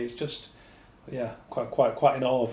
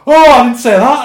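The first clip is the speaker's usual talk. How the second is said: much louder, high protest; panicked denial; 13 semitones higher, 19 dB louder than usual. Retold or imitated. Officer protesting to his manager over the quote. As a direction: blurt it out in shock. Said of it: "And I'm like".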